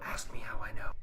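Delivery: hushed conspiratorial whisper. Acted. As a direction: lean in and whisper it like a secret. Conspiratorial aside between jets.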